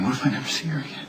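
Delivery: fast, despairing whisper. Acted. Nicholas whispering his fear to Jacob. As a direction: let the fear slip out.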